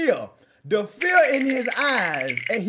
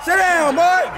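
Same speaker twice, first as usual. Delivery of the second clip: raised, punchy game-play shouts; excited trash talk; 5 semitones higher than usual. Real, not acted. Gamer taunting an opponent to sit down, excited shouts. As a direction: crow over a big hit.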